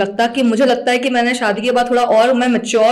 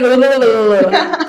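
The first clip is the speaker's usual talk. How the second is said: express-train gabble. Retold or imitated. Guest mimics her college presentations, comic rapid gabble. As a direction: imitate yourself gabbling nonstop. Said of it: "मैं राजधानी express थी".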